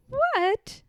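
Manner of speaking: high startled yelp. A narrator's reading. Piglet startled by Pooh's cry, high and jumpy. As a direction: jump at the sound. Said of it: "said Piglet with a jump".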